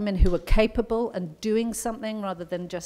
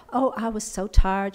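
put-on American accent; 2 semitones higher than usual. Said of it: "excuse my American accent".

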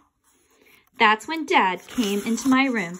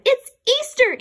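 plain reading voice

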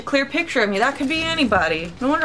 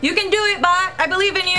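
cheering on; 9 semitones higher, faster than usual. Real, not acted. Cheering on his bot.

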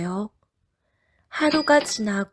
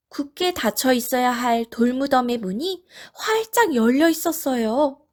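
usual speaking voice

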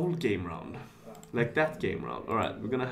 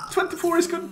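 excited; 5 dB louder, faster than usual. Real, not acted. Repeats number choice, first take.

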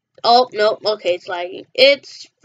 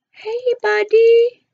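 taunting sing-song; 8 semitones higher, slower than usual. Pretend. Taunting enemy player in game.

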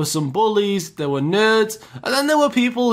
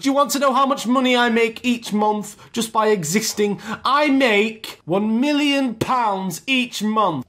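higher, boastful snob voice; arrogant rich kid; 6 semitones higher than usual. Retold or imitated. Rich kid boasting of his monthly money to the teacher, arrogant. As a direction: brag grandly, slowing right down for the boast. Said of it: "going on proper arrogant".

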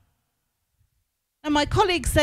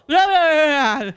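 mocking blah blah; 4 semitones higher, 5 dB louder than usual. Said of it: "mocking the colleague's rant".

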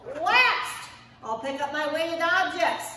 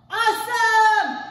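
drawn-out loud cheer; excited shout; 4 semitones higher, 8 dB louder, slower than usual. Real, not acted. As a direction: celebrate their finish. Coach cheers at workout end.

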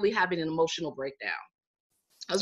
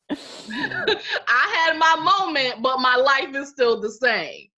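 laughing; 6 semitones higher, 6 dB louder, much slower than usual. Real, not acted. Laughing at own story.

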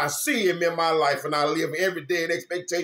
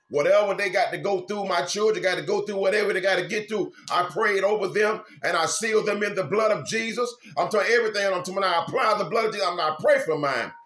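raised, driving preacher's voice; preaching at full force; 5 semitones higher, 4 dB louder than usual. Acted. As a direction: preach it out to the flock. Preaching to viewers.

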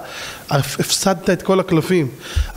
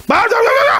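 mocking squeak; 5 dB louder than usual. Mocking the hitting father.

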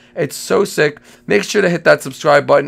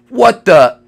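loud, higher startled cry; shocked shout; 5 semitones higher, 10 dB louder than usual. Real, not acted. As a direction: blurt it out in shock. Shocked repeat.

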